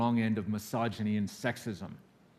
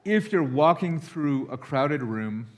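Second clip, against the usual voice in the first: ordinary talk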